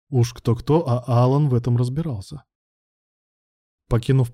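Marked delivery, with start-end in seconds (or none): none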